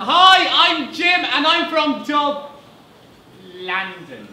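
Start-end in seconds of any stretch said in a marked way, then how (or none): 0.0-2.5 high, loud, slow start. Acted. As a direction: blurt it out high then catch yourself. Jim nearly says Dublin.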